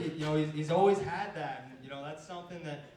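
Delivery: normal talking voice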